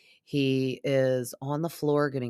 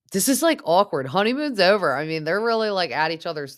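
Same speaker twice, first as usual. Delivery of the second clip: excited gossip; 5 semitones higher, 6 dB louder than usual. Real, not acted. Gossip commentary raised.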